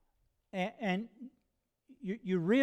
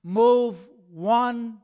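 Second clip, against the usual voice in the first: emphatic plea; 2 semitones higher, 7 dB louder than usual. Acted. Preacher voices Paul's prayer to God, emphatic plea. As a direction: plead it out, leaning hard on the key words.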